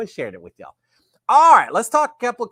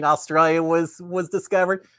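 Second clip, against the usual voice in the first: usual speaking voice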